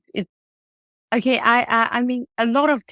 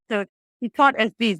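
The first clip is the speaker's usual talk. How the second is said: normal talking voice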